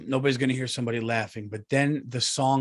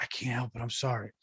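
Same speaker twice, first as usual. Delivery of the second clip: hushed apology; 10 dB quieter, faster than usual. Retold or imitated. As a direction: apologise under your breath, acting it out. Whispered apology to wife in concert.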